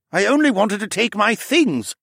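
high-pitched, forceful protest; insistent. A narrator's reading. Captured mage excuses himself to captor, insisting. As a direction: insist you meant no harm. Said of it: "he insisted".

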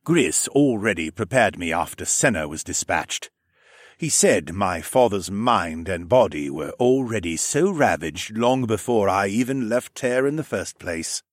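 measured, formally voiced lizard man; sombre raptor man. Raptor man speaking of his father's decline, measured and restrained. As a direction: state it with restrained grief.

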